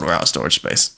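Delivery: ordinary talk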